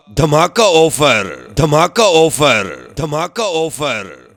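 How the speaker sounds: slow drawn-out slogan. Acted.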